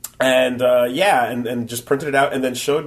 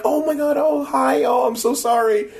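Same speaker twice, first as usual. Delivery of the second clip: high, breathy-free squeaky cute voice, much higher; mock shy cute girl; 12 semitones higher than usual. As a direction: imitate a bashful, overly sweet booth girl. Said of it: "Like, she's super, like, like".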